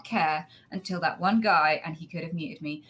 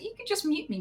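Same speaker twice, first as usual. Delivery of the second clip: placating; 11 semitones higher than usual. Retold or imitated. Placating reply to angry teammate.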